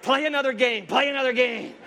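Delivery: high, goading mascot voice; Satan as Chuck E. Cheese. Acted. Tempter urging the player on, high mock mascot voice. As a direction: coax like a tempting game mascot. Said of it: "there's Satan, you know, Chuck E. Cheese".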